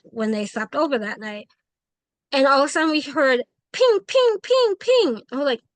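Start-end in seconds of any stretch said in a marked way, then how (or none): 4.1-5.2 imitated pings. Imitating hail hitting the house.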